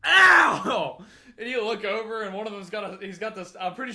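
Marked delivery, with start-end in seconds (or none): 0.0-1.0 sudden loud high yelp of pain. Retold or imitated. Classmate yelping after getting stapled, loud and high. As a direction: cry out as the stapler bites.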